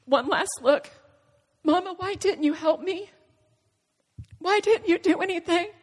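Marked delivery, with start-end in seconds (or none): 1.6-5.7 put-on higher-pitched child questioning Mama; pleading child. Dying son's last look to his mother, pleading. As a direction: ask it as a hurt, pleading child. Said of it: "communicating in our own language".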